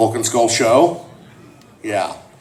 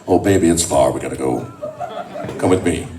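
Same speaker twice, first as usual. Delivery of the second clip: low-pitched, mock-tender sweet talk; hammed-up low lover voice; smooth boyfriend act; 12 semitones lower, 4 dB quieter than usual. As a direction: drop low and play the protective boyfriend. Acts out boyfriend reassuring her.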